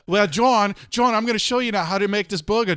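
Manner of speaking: high, loud mock TV pitchman; infomercial pitchman. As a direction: imitate an over-eager British or Australian infomercial host. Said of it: "have an Australian guy or a British".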